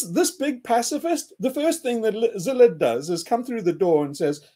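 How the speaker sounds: ordinary delivery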